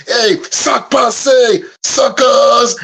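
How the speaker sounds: high, drawn-out mock wrestler taunt, slow; hammed-up entrance taunt. Host imagining the guest's tag team entrance, hammed-up high taunt. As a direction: stretch out the taunt like a heel coming to the ring. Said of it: "coming out going".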